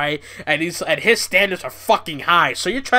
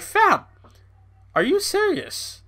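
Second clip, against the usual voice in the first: incredulous; 14 semitones higher than usual. Real, not acted. Disbelief at the GoFundMe.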